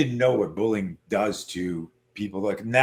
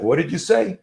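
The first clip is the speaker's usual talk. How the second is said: louder, higher confrontational question; mock menacing comeback, pitched up; retold challenge; 7 semitones higher, 5 dB louder than usual. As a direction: imitate a bully squaring up. Bully squares up in retold fight.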